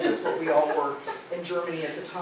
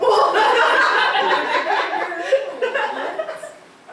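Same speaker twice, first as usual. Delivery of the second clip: loud, high burst of laughter; big laugh that tapers off; long laugh; 9 dB louder than usual. Real, not acted. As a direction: laugh hard, high-pitched. Laughing with the class.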